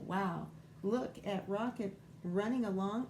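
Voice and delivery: ordinary delivery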